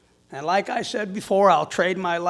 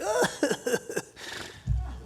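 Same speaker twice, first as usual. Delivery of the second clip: laugh; 10 semitones higher, 4 dB quieter than usual. Real, not acted. Laughing at his own story.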